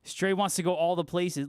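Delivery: ordinary delivery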